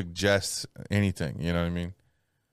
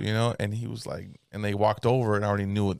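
ordinary delivery

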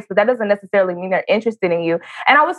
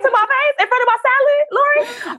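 outraged squeal; 14 semitones higher than usual. Real, not acted. Complaining to Lori about her mom, high outraged squeal.

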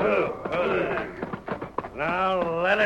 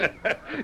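gloating laugh; 8 semitones higher, 5 dB quieter than usual. Acted. Outlaw gloats over trapped Ben.

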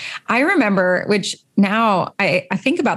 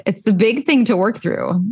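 usual speaking voice

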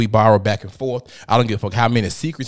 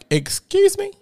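high squeak of disbelief, much higher; shocked excuse me; slower than usual. Retold or imitated. Caught off guard by her ex-friend's accusation, shocked squeal. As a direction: recoil in sudden disbelief.

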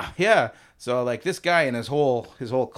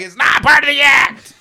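high, loud insistent shout; 14 semitones higher, 12 dB louder than usual. Retold or imitated. Shouting at guests who think the death is an act. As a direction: bellow it over the crowd. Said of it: "we're like".

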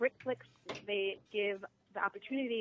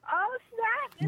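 delighted surprise; 3 dB louder than usual. Real, not acted. Excited reaction to Liberian connection.

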